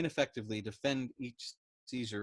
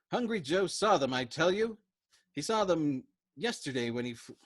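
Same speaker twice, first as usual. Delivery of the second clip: agitated Dobbs; 6 semitones higher, 5 dB louder than usual. Acted. Dobbs ranting about Hungry Joe.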